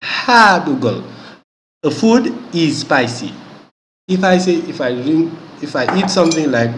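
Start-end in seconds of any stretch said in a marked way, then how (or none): 0.0-1.0 hadugol drawn out slowly, each syllable clear; slow Fula word demo. Shown as a demonstration. Teacher saying hadugol slowly to class, drawn out. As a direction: spell out hadugol slowly for learners. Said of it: "To be spicy".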